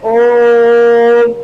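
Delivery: ओम with the ओ held three beats, slow; ओम sung out with a prolonged ओ; drawn-out pluta Om. Shown as a demonstration. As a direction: hold the ओ of ओम long like a chant. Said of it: "ओ को आपने तीन बार बोला".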